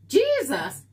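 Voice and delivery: a loud, high gasp of surprise; surprised exclamation. Real, not acted. Streamer reacts to a viewer's bake list, loud and surprised. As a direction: blurt it out, taken aback by the list.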